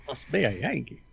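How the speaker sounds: hammed-up Southern local; mock Southern accent. Southern locals sizing up a Yankee stranger, mock Southern accent. Playing locals spotting a Northerner.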